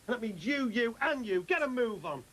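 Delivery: loud, high barked orders; angry commands. Acted. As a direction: shout orders at the lads. Dad ordering mates out.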